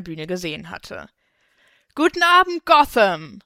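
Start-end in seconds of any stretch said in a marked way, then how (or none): 1.9-3.4 loud, high stage shout; big concert-opening call; calling into the mic. A narrator's reading. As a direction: greet the crowd like a rock star, high and loud. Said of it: "rief sie ins Mikro".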